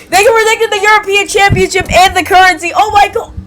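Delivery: high-pitched, mock-astounded outburst; excited revelation. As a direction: build to an amazed exclamation. Excited mock revelation while reading.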